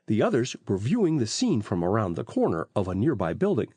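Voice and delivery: ordinary delivery, nothing marked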